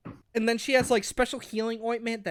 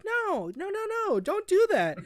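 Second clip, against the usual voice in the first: mock protest; 10 semitones higher than usual. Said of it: "I'm like".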